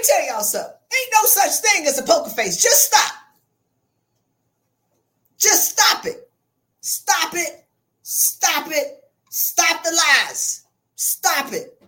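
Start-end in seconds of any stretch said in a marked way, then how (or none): 2.6-11.5 loud scold. Real, not acted. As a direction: cut them off hard. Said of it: "scolding audience".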